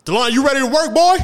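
loud, high-pitched call-out; imitated yelling. Coworker coming out of the office hailing him, imitated loud yell. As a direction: holler it like an overeager coworker. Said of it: "yells my name out of everybody's".

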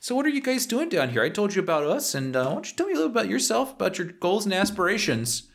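put-on high, chatty Minnesotan accent; Canadian or Minnesotan accent. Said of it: "slowly morph into Canadian or Minnesotan".

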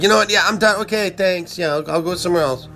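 high huffy customer voice; annoyed customer. Retold or imitated.

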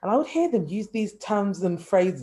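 normal talking voice

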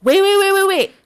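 high, loud repeated wait; mock urgent call. Retells stopping friend to record.

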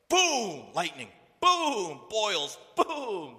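very loud, high booms; explosive smiting. Acted. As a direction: fire off each blow like thunder. Acting out God hurling down punishments.

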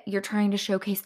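ordinary talk, nothing marked

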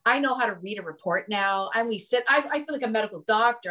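ordinary talk, nothing marked

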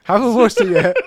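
over-strong start. Acted. Host mocks own strong start, loud and high. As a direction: belt out the opening line. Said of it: "I started into it so strong".

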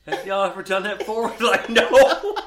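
loud, high mock question, cracking up; laughing retold question.

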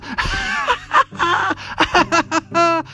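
high, uproarious acted laugh; roaring laughter. A narrator's reading. Genie seeing elephant on magic carpet, roaring laughter. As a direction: burst into long, high peals of laughter. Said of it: "the genie roared with laughter".

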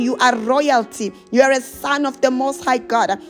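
ordinary delivery

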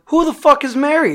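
high-pitched incredulous question; retold exasperated disbelief; baffled outrage. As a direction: demand it in sheer bafflement, pitch up. Baffled demand to grave digger.

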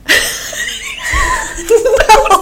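high shrieking burst of laughter; big laugh. Real, not acted. Laughing at seventy.

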